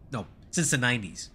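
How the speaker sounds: ordinary talk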